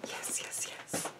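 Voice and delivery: whispered yes yes yes; hushed thrilled whisper; excited whisper. Real, not acted. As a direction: breathe it out in delight. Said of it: "I'm getting a little too excited".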